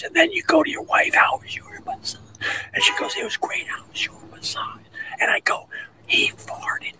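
hushed whisper, as in a silent spa room. Retold or imitated. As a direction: keep it to a breathy hush so nobody hears. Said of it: "I wish I could speak my normal voice".

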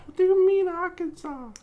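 very high, loud incredulous mock; meme girl voice. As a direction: squeal the question in mock disbelief. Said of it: "America, explain".

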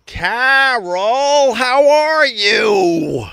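high, slow drawn-out Carol, how are you; high crooning hello; sing-song greeting. Pretend. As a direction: stretch the greeting high and slow like a lounge singer.

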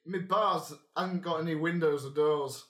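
hearty Northern innkeeper brushing it off; northern accent. Acted. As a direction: GM as barkeep, reassuring the adventurer in a Northern accent. GM voicing barkeep.

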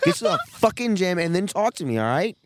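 loud, high, furious rant at her; tearing into her, yelling; angry rant. Real, not acted. Ranting at a classmate, loud and furious.

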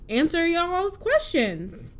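slow, high, stretched answer y'all's questions; drawn out. Acted. Host teasing the audience, slowly drawn out. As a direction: stretch it out playfully.

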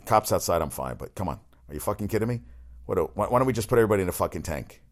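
ordinary talk

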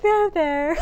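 high, loud, mock there-there with a laugh; mock soothing. Friend mocking a mom consoling her baby, high and laughing. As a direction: pat it away, laughing. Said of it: "And you're like".

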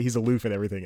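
normal talking voice

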